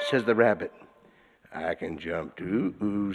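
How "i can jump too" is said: low, slow bear voice; menacing bear. A narrator's reading. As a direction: rumble it down like a huge hungry bear. Bear answers rabbit.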